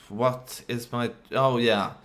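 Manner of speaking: ordinary talk, nothing marked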